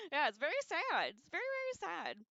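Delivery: overplayed sorrow at the death; mock-dramatic sad. Real, not acted. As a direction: ham up how sad it is.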